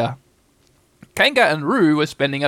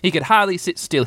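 plain reading voice

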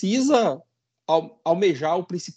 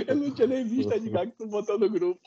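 giggling while talking; laughing; 6 semitones higher than usual. Real, not acted. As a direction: laugh through the line. Laughs at the group message.

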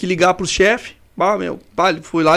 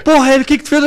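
higher, sharper mock scold from the boss; 7 semitones higher, 3 dB louder, faster than usual. Referee's boss scolding him over the phone, mock exasperated.

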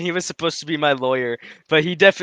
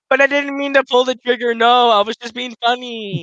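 mock pleading; 7 semitones higher than usual. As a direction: plead innocence like a caught kid. Acted soldier excuse.

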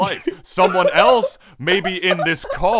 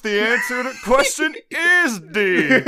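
game show host; 8 semitones higher, 5 dB louder than usual. Pretend. Quiz show host bit.

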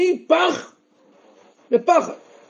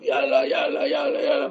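mock lost-voice shouting; 9 dB quieter than usual. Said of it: "התחילו לצעוק".

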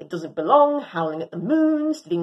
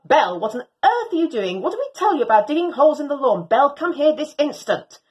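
louder, fast scolding; mad owner scolding; 5 semitones higher, 4 dB louder than usual. Acted. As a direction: tell the dog off sharply. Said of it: "sounds real mad this time".

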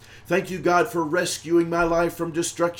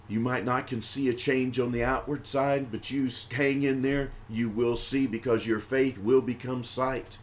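softer, lower encouragement; quiet reassurance; 3 semitones lower, 5 dB quieter than usual. Real, not acted. Preacher telling the struggling to hang in there, softly. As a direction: drop down and gently reassure.

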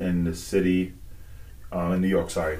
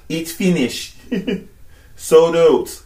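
mock rude server; 8 semitones higher, 3 dB louder, much slower than usual.

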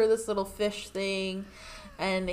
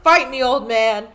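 challenge; 4 semitones higher, 9 dB louder than usual. Retold or imitated. Katara challenging the master.

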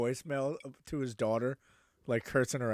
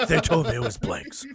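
a loose, laughing take on Alec Baldwin, pitched up on the last line; mock Alec Baldwin, half-laughing; lightly voiced Alec Baldwin, laughing through it; Alec Baldwin impression; 10 semitones higher, 6 dB louder than usual. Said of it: "that was my, uh, Alec Baldwin, uh, impression".

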